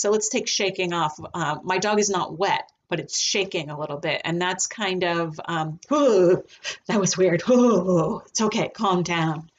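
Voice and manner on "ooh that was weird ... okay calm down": voicing the dog. Voicing the dog's inner thoughts.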